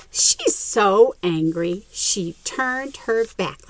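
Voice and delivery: plain reading voice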